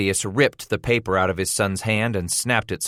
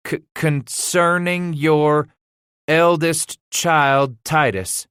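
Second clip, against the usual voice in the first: higher, slow, stumbling word by word; halting, faltering reading; 7 semitones higher, 4 dB louder, slower than usual. A narrator's reading. Boy struggling to read a schoolmaster's letter to father, halting. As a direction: sound out each word, stammering on hard ones. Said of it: "faltering, halting at nearly every word".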